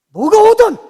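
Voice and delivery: sudden high, booming burst; defiant shout. Real, not acted. Preacher vowing to face whatever comes, defiant shout.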